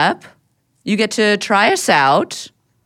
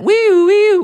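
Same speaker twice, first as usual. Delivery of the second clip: high, loud wee-oo; two-note vocal alarm; mock announcement siren; 12 semitones higher, 6 dB louder than usual. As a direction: wail like a little siren. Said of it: "that's not the announcement sound".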